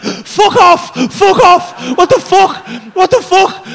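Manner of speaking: high, loud, disbelieving yells; panicked shouting. Retold or imitated. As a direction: yell in shock at a world gone mad. Panic as world turns cartoon.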